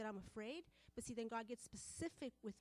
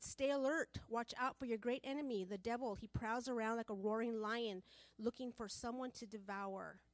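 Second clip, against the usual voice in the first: loud, forceful proclamation of the verse; scripture reading; 5 dB louder than usual. Acted. Preacher warns the women with First Peter, loud. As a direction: declaim it like a warning.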